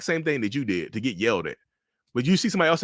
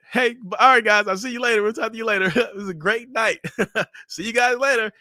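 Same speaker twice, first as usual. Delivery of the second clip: higher, chipper goodbye with laughs; fake cheerful host; 10 semitones higher than usual. Narcissist cheerfully seeing guests out the door. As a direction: put on a sweet public face.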